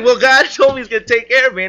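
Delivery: high, fast, loud defence; loud protest. Retold or imitated. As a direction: protest hurriedly over the scolding. Retold protest while being smacked.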